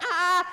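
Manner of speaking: a high anguished cry. Retold or imitated. Weeping men on the dock crying out. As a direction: let out a pained "Ah". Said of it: "They're like".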